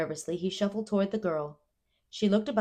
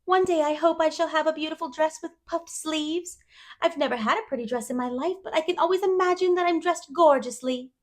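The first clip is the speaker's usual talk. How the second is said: put-on higher, eager girl voice; dreamy chatterbox girl; 11 semitones higher, 7 dB louder than usual. A narrator's reading. Anne telling Matthew about dresses, wistfully eager.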